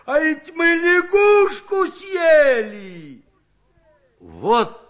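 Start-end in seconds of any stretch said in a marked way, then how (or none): 0.0-3.2 slow, high, loud shout of disgust; horrified shout. A narrator's reading. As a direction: bellow the awful truth to everyone. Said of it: "воскликнул потрясённый".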